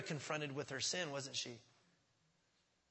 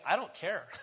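casual; 3 dB louder than usual. Retold or imitated. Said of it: "He's like".

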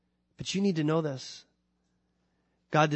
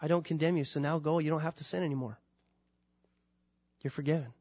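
gentle Lord's voice; 4 dB quieter, faster than usual. Acted.

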